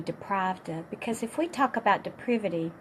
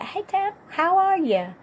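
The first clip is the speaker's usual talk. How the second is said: bright, much higher, upbeat "hey Dad"; cheerful greeting; 10 semitones higher, 5 dB louder than usual. Shown as a demonstration. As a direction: greet him as if you're glad to hear from him. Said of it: "Maybe talk in a way that".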